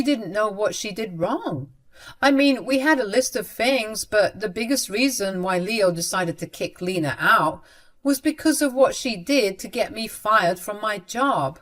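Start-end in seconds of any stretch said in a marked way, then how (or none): none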